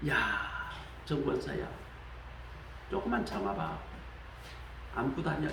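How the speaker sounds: soft, hushed comfort to a suffering believer; gentle God voice. Retold or imitated. Preacher voicing God soothing a deaconess, softly. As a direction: quietly soothe her like a kind father.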